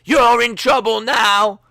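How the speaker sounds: loud, high, slow taunting chant; hammed-up crowd yelling, high and slow; mock crowd shout. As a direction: jeer like a hyped crowd. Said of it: "the crowd are going".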